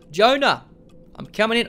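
loud, high playful announcement; mock-barging-in shout; calling out. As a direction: call it through the door. Calls out before entering the office.

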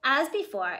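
ordinary delivery, nothing marked

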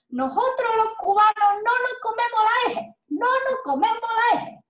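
high, indignant protest; imitating a cross Cuban woman insisting; angry Cuban woman. Teacher imitating an annoyed Cuban at a congress. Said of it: "estaba muy enojada".

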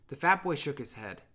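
ordinary delivery

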